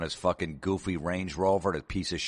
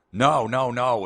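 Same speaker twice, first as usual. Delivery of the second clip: insistent correction; 6 semitones higher, 7 dB louder, slower than usual. Acted. Customer insists, correcting clerk.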